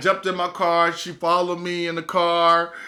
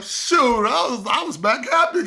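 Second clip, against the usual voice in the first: ordinary delivery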